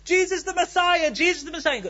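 high, put-on mock scream; excited street crier, acted out; imitated screaming. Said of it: "two blind guys screaming in the street".

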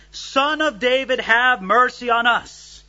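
mock scream, slow and insistent at the end; imitated screaming. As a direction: cry out like someone desperate to be heard. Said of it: "they started screaming".

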